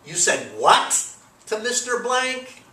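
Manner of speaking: "what" rises sharply, the rest slow and drawn out; stress on what. Shown as a demonstration. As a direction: lean hard on "what" as if you can't believe it. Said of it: "the word what is emphasized in the speech".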